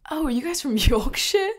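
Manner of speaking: imitated British driver, high-pitched; giggly mock British question. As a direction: lift the pitch, put on British. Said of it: "And the driver was like".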